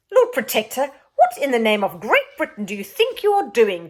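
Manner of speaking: loud, high, indignant demand; the Queen rounding on the Lord Protector. Acted. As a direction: confront him with royal outrage. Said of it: "demanded the Queen".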